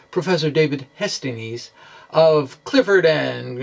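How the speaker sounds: ordinary delivery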